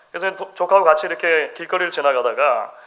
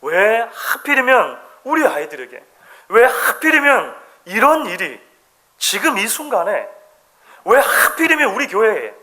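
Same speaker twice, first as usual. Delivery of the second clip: higher, slow rising protest; bitter complaint; 5 semitones higher, slower than usual. Retold or imitated. Preacher voicing a congregation's resentful questions, mock complaint. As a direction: lament building in frustration. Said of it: "이런 생각들이".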